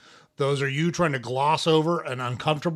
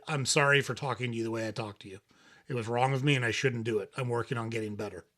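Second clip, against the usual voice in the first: quiet, lower, gentle apology; soft sincere apology; 4 semitones lower, 7 dB quieter, faster than usual. Retold or imitated. Husband apologizing to his wife, soft and sincere. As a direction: say sorry softly and mean it. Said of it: "I said".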